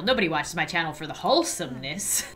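ordinary talk, nothing marked

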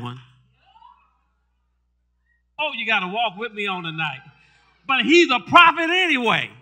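loud, high, fervent preaching with the congregation; full-force preaching. Acted. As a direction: preach it hard, building to shouts.

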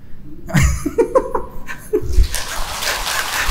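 a high unvoiced laugh, rising; breathy high laugh. Real, not acted. Laughing at the story. (tiếng cười hơi cao vút)

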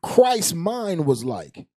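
preaching. Acted. Preaching at full force.

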